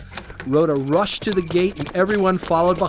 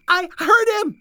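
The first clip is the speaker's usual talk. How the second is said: put-on very high, louder girlish protest; insistent young maid; 13 semitones higher, 3 dB louder than usual. A narrator's reading. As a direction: insist against disbelieving friends. Rhoda argues with disbelieving friends.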